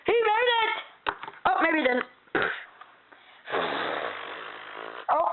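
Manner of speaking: excited race announcer calling it; live play-by-play. Acted. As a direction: call the race like a sports commentator. Race commentary.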